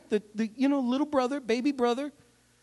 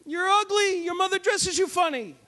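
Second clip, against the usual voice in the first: taunting enemy; 9 semitones higher than usual. Retold or imitated. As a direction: jeer across the valley at the enemy. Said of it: "The Philistines come along".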